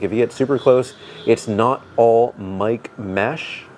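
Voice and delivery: usual speaking voice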